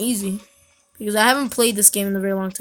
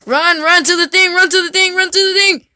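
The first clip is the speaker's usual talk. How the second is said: higher, louder urgent calls; urgent shouting; 7 semitones higher, 6 dB louder than usual. Real, not acted. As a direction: yell at a friend to run. Urging friend in game.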